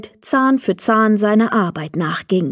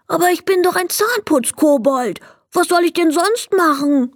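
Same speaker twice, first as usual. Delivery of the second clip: high, plaintive little kobold; mildly hurt protest, pitched up; sad; 8 semitones higher than usual. A narrator's reading. Kobold losing his job to Leni, sadly plaintive. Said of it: "fragte er traurig".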